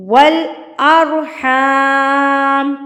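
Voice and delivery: والارحام drawn out slowly in recitation. Shown as a demonstration. Teacher reciting the verse for her students, slow and stretched. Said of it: "ساتھ ساتھ وقف کا بھی بتاتی جاتی ہوں".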